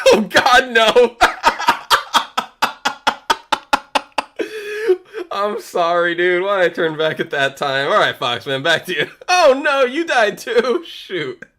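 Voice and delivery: high loud helpless laughing; cracking up while apologizing. Real, not acted. Laughing after sudden death.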